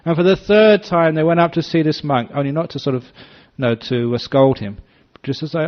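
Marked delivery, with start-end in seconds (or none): none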